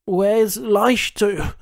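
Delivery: a hurt man gasping for his friend; gasped in pain. A narrator's reading. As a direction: gasp the name out through pain. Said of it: "he gasped".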